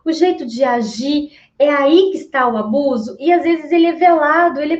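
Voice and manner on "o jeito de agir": ordinary delivery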